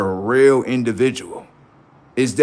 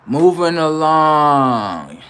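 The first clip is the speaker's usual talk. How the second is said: higher, very stretched-out call; drawn-out announcement; 4 semitones higher, 4 dB louder, much slower than usual. Pretend. Comic moving the live on after a roast, long drawn-out call. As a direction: sing out both words long and slow. Announces next topic, then repeats normally.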